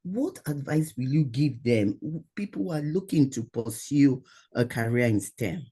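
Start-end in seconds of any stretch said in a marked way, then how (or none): none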